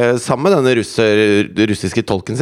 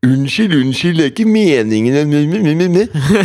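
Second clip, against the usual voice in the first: høy, sutrete og langsom unnskyldning; whiny mock apology; 4 semitones higher, much slower than usual. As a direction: syter ut en unnskyldning. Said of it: "hvis du går rundt og sier sånn". (sytete, ynkelig stemme)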